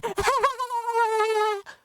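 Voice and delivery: squeaky burst of laughter; high giggle. Real, not acted. Laughing at his own falsetto parody, high giggle. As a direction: crack up at your own voice.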